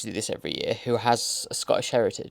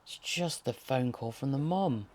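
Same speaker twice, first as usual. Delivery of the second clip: normal talking voice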